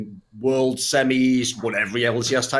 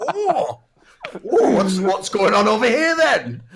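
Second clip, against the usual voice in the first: much higher, slow put-on voice; mock nosy rich guy; 6 semitones higher, 4 dB louder, slower than usual. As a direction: leer over slowly, hammed up.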